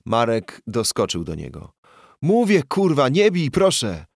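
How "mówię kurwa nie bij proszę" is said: high, loud begging cry; terrified yelp for mercy; frantic pleading. Acted. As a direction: beg him to stop hitting. Beaten man begs to stop.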